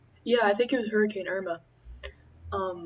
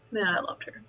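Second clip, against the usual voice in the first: wistful whisper; 4 dB quieter than usual. Real, not acted. Missing her lost frog.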